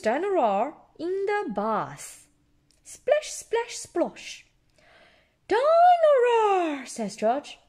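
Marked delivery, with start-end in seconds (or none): 2.8-4.4 tag. A narrator's reading. Bath splashing sounds in story. 5.5-6.9 high, loud, drawn-out cry; stretched squeal of delight; excited little George. A narrator's reading. George cries toy's name.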